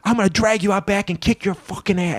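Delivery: put-on high-pitched drunken tirade; Sandman. Drunk veteran threatening two new wrestlers, high belligerent tirade. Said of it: "this is me doing my Sandman".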